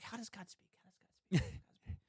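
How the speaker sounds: hushed question sinking into a whisper; acted-out private wondering, nearly inaudible; fading to whisper. Retold question to self, fading away.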